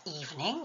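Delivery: evening with the first syllable stressed. Shown as a demonstration. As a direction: punch the first syllable of evening, EVE-ning. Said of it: "il faut mettre l'accent tonique sur la première syllabe".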